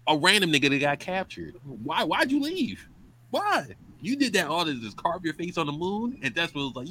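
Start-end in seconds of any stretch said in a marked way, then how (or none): 1.8-6.2 exasperated disbelief. Real, not acted. Exasperated questioning of villain.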